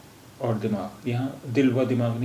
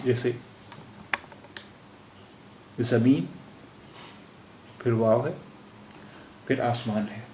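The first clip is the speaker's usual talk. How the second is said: ordinary delivery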